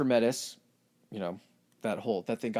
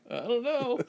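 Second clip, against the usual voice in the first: much higher, helpless shrug; sheepish Zeus; 12 semitones higher than usual. Retold or imitated. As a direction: squeak out a guilty answer. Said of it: "He's just like".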